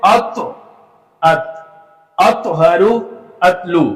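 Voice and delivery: अत with the t bounced, then plain; qalqala contrast. Shown as a demonstration. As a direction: recite अतहरू and अतलु side by side. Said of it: "इसी तरह अगला देखें".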